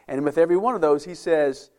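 ordinary delivery

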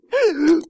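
sharp, high, loud gasp; shocked gasp. Shown as a demonstration. Preacher acting his reaction to scripture, loud gasp. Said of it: "you know what I'm doing?".

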